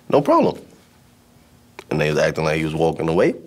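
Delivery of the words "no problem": mock casual. Bully feigns backing off.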